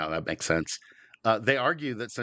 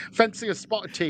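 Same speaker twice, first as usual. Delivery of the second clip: higher, hammed-up English voice; mock British accent; 8 semitones higher than usual. Joining the London bit, in a mock British accent. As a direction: offer tea like a London local. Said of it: "That was, that was sort of Cockney".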